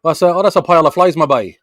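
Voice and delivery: put-on loud, high Gaspé accent. Quebec man imitating how people in Gaspé talk, Gaspé accent. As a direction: imitate a lively Gaspé local, loud and lilting. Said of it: "they speak fast and, like".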